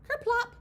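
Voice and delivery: loud high kerplop. Acted. Reading to kids, a loud high splashy kerplop. Sound word of falling cones.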